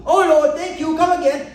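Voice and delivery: cartoon shopkeeper's mock Indian accent; Apu impression. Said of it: "With Apu".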